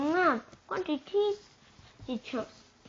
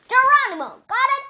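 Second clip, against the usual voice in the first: "Geronimo" screamed much higher and louder; furious shout; 13 semitones higher, 15 dB louder than usual. Acted. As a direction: blow up and bellow "Geronimo". Angry name quarrel.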